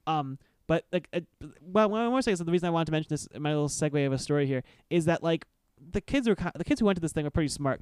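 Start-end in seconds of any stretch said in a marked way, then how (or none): none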